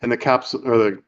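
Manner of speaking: ordinary delivery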